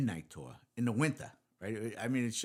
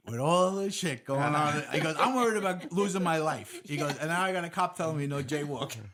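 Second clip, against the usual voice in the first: louder, higher mock indignant rant; mock outrage; 7 semitones higher, 8 dB louder than usual. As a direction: play up the friend's exasperated protest. Friend's retort to a jaywalking joke.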